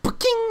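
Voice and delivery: high text-alert noise; imitated phone chime. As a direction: pop out a high, bright phone ping. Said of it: "my phone goes".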